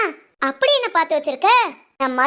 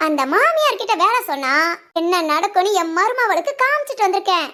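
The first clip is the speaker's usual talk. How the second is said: ordinary talk, nothing marked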